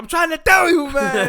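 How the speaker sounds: high, loud imitated yell; hammed-up scolding of a workmate; exasperated worker. Other worker yelling at his partner.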